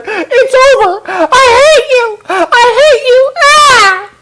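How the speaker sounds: high shrill-free falsetto mock of a girl dumping her man, slow on I hate you; hammed-up high girlish voice. Ex-girlfriend at the restaurant, mock high-pitched breakup cry. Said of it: "and she's like".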